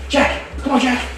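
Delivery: high, eager coaxing call; excited calling. Real, not acted. Keeper calling the lace monitors out for food, high and eager. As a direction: coax the lizard out with a high voice.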